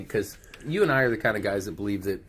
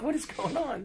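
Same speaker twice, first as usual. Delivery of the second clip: much higher, confused laughing question; baffled; 8 dB quieter than usual. Pretend. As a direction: ask it like a baffled gym mate. Fighters baffled by the scene.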